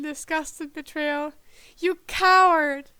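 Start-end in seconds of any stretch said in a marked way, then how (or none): 1.8-2.9 sharp accusing outburst, cut short; mild muttered accusation. A narrator's reading. Bird Cece scolding the deserter, indignant. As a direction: call him a coward. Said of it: "Cece muttered".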